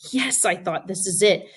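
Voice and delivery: plain reading voice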